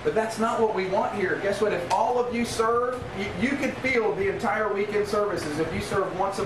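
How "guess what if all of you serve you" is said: usual speaking voice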